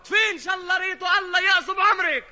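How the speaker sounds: high, loud furious cursing; angry shout. Acted. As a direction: yell the curse at him. Curse shouted at Khalil.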